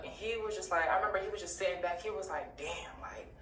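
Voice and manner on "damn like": breathy whispered "damn" under the breath; hushed whisper. Retold or imitated. Friend whispering stunned to himself in the car. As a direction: whisper it low, shaken, to himself. Friend reacting after the act.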